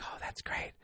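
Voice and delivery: barely-there, whispered reply; voiceless whisper. Retold or imitated. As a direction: imitate a mom with no voice. Mimics mother who lost her voice.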